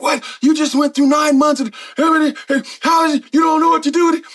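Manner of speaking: high, loud exasperated outburst; infuriated. Retold or imitated. Pastor scolding the young man, high exasperated shout. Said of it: "he was f-, infuriated".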